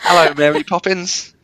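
high, slow posh English voice; hammed-up British accent. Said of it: "doing my Harry Potter again".

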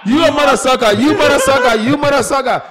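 put-on high, loud African accent comic shout; loud comic catchphrase. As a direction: bellow the catchphrase like on stage. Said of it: "I'm like".

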